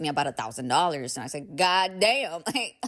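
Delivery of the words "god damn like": god damn into a real laugh; laughing at the repair bill; laughing disbelief. Real, not acted. Laughing at a thousand dollar bill, laughing.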